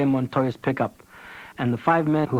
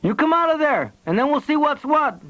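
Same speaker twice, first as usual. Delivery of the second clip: calling out; 14 semitones higher than usual. A narrator's reading. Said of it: "Bernabe called".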